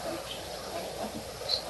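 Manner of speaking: slow breathy whisper, no voice; whispered to herself. Retold or imitated. A child racking her brain, whispering slowly. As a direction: let it fade to a whisper.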